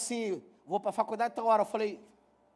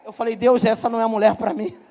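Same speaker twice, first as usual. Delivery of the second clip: louder, laughing through the punchline; 2 semitones higher, 9 dB louder than usual. Real, not acted. Pastor laughing with the congregation at his own story. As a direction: crack up while telling it.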